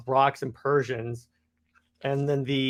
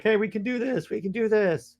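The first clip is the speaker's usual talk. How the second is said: high, eager mock pep talk; excited; 8 semitones higher than usual. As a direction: pump the team up, voice jumping high. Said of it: "excited because they're like".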